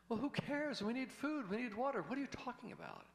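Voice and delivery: very high, exasperated frantic voice; shrieking panic. Acted. Nervous guy yelling that they need food. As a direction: screech at your friend in disbelief.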